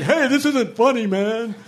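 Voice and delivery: high-pitched, indignant protest, imitating an upset bandmate; really upset. Bandmate scolding Branson after the Jeep slid off the road, upset. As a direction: play a rattled friend objecting, pitched well up. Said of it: "got really upset and was like".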